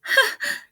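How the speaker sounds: very high, loud squeal of a laugh; hammed-up rhinoceros laugh, very high; high burst of laughter. A narrator's reading. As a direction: let a giggle burst out high.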